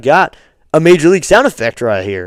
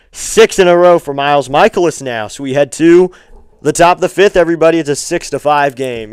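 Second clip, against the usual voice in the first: louder broadcast wrap of the inning; announcer; 5 dB louder than usual. Acted. Streamer telling viewers the inning is over, announcer voice. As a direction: sign off the inning to everybody.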